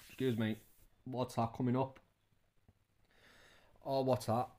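normal talking voice

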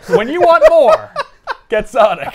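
loud, high, slow ad shout through laughter; over-the-top pitchman yell; mock commercial slogan. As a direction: belt out a joke jingle line, cracking up. Improvised fast-food commercial slogan.

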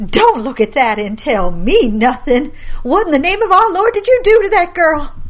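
grandma tearing into her grandson; furious scolding. Acted.

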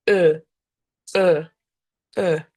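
«Е» from the throat with lips rounded; rounded e demo. Shown as a demonstration. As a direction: say e with lips in a tube. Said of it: "ви ніби хочете сказати «е»". («е» з губами трубочкою)

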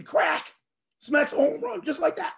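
high, punchy play retelling; excited re-enactment. Retold or imitated. Fan retelling a home run hit on cue, excited.

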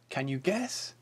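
ordinary delivery

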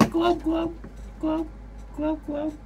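squeaky fish glubbing through laughs; gurgling fish man croak. Retold or imitated. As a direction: blub like a cartoon fish, giggling. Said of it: "the noise a fish makes is glub".

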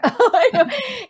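bright high burst of laughter. Real, not acted. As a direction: laugh at her own joke. Laughing after accent joke.

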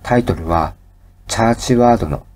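ordinary delivery

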